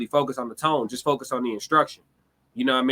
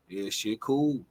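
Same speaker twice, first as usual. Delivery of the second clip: much softer and lower, deadpan shrug; unbothered; 4 semitones lower, 6 dB quieter, slower than usual. Retold or imitated. Replying to an overexcited friend on the phone, soft deadpan calm. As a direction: answer the hype completely unimpressed. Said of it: "I'm just like".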